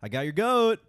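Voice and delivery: loud, triumphant taunt; gleeful taunt. Pretend. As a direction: crow it out loud. Repeated taunt line.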